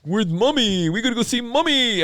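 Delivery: put-on high, fast baby talk. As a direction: coo it like a toddler. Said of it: "there's like a baby voice".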